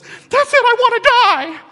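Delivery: high, loud diva wail; hammed-up prima donna cry; melodramatic wail. Mocking a prima donna wailing about wanting to die, high and loud. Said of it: "when someone says".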